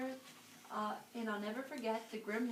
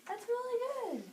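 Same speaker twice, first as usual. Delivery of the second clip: praise; 10 semitones higher than usual. Real, not acted. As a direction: cheer the little one on. Praising child listener.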